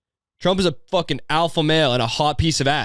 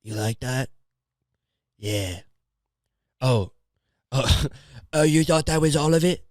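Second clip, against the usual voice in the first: put-on British accent. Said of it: "I just gave him a British accent".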